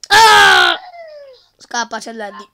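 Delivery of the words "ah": sudden scream; being chased. Real, not acted. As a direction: scream in fright.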